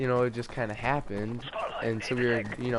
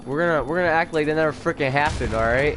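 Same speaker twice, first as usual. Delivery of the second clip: mad; 4 semitones higher than usual. Real, not acted. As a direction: insist on it, annoyed and raised. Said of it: "I'm pretty mad".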